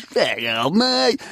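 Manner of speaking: words mashed together, leaping up on the last; slurred meat-pie bogan heckle; blurred bogan slur. Retold or imitated. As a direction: imitate a mouth-full-of-pie bogan. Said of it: "he just blurred a, a meat pie kind of".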